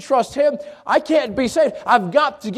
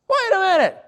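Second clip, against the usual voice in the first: high, startled wait a minute; pitched-up double take; sudden disbelief; 9 semitones higher than usual. Pretend. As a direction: catch yourself mid-thought, incredulous. Rope advice seems absurd.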